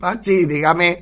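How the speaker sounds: slow, amused mimicked calls; laughing mock call and reply; imitated balcony exchange. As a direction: draw out both calls playfully.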